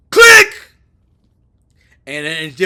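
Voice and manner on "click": high, very loud shouted click; mock hang-up click. Trump hangs up on Mexico's president, a shouted click. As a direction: punch out the phone click sharply. Acting out slamming the phone down.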